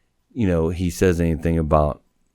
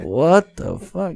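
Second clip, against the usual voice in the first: disbelief; 8 semitones higher, much slower than usual. Retold or imitated. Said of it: "I'm like".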